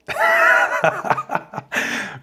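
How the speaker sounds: high, breathy burst of laughter. Real, not acted. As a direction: crack up hard at your own joke. Laughs at his own comeback.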